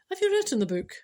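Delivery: asked casually with a sarcastic edge; teasing an old friend about her unwritten book; mock-casual sarcasm. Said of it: "just with the underlying tone of sarcasm".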